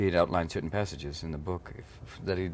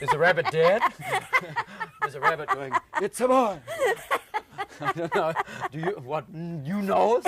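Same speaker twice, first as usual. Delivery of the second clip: very high, laughing falsetto questions; giggly squeal; 4 dB louder than usual. Real, not acted. Joking with the interviewer about pregnancy, laughing falsetto.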